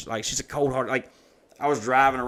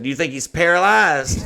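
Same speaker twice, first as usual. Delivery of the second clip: imitating a kid's loud, high question; loud child; 5 semitones higher, 8 dB louder than usual. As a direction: blurt it out in front of everyone. Son blurting question in crowd.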